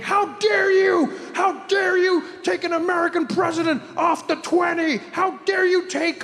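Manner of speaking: mock outrage, yell it; outraged shout. Comic imitating furious Americans over the twenty dollar bill, shouting. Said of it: "They're like".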